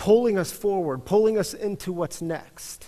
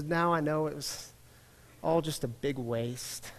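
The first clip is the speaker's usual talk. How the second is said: softer, let-down admission; deflated; 2 semitones lower, 8 dB quieter than usual. Retold or imitated. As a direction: let it sink with disappointment. Rabbi admits defeat.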